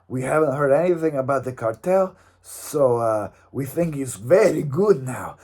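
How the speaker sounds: hammed-up gym ad in a fake Mexican accent; mock Mexican accent ad. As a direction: sell the gimnasio like a cheesy local commercial. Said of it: "that's not a good Mexican accent".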